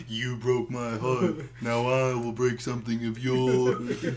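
slow, drawn-out mock villain threat; mock menace. Friend teasing him about revenge on his ex, slow mock villain line. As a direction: stretch every word like a hammy avenger.

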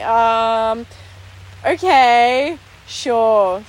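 drawn-out, unsure acceptance; acted-out dubious going along; hesitant agreement. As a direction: stretch each word as you reluctantly give in. Acting reluctant agreement to friends' prank.

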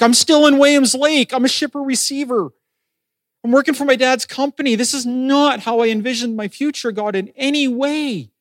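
high-pitched complaint to God; frustrated, upset. Retold or imitated. Young man venting to God about his stalled calling, frustrated. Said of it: "I'm getting frustrated and I'm getting upset".

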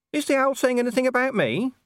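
very high nervous dog voice; small dog voice. Acted. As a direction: ask like a twitchy little mutt. Gaspode the dog asks nervously.

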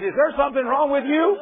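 high, loud indignant challenge; mock irate driver. Driver confronting the preacher who kept honking, loud indignant question. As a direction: bark it like the angry driver.

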